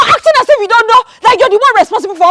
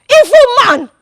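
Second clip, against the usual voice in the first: usual speaking voice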